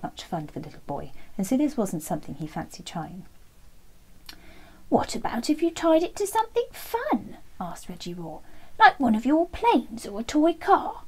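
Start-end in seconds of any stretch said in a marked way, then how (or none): 4.9-7.4 higher, bright-eyed suggestion from a toy dinosaur; eager dinosaur voice. Acted. Dinosaur friend suggests an idea. 8.8-11.0 higher, louder and slow, keen to help; drawn out like a keen little dinosaur; eager dinosaur voice. Acted. Dinosaur friend suggests an idea.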